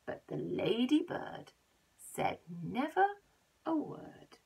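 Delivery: plain reading voice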